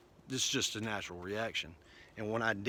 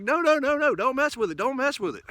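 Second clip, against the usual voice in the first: much higher, louder effeminate panic; panicked feminine coworkers; 13 semitones higher, 7 dB louder than usual. Retold or imitated. Clerks begging him not to touch the arm, high and panicky. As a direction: squeal it out like frantic clerks. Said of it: "they were feminine".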